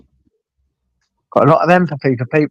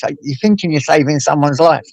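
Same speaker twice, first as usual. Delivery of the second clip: ordinary delivery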